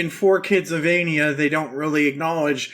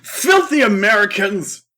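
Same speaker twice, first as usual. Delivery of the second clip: raised, indignant cry; mock outrage; 3 semitones higher, 4 dB louder than usual. Recapper plays Kaiba disgusted by the cartoon, mock outrage. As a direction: spit it out as a comic insult.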